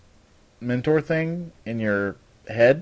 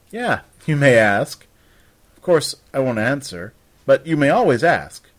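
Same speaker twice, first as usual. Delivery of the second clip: playful teasing; 4 semitones higher, 9 dB louder than usual. Acted. Raven teasing the Magister.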